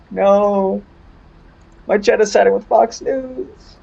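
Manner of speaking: playful indignant whine; mock betrayal. Real, not acted. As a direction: whine at chat for betraying you. Mock outrage at chat.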